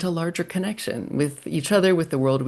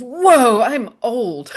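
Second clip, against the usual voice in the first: amused surprise; 9 semitones higher, 5 dB louder, much slower than usual. Retold or imitated. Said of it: "the first thing that comes is".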